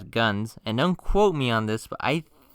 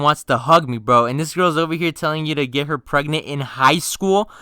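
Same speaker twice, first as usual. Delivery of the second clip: loud, worked-up retold outburst; exasperated rant; 3 semitones higher, 7 dB louder than usual. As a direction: rant at your friend in disbelief. Exasperated acted rant at friend.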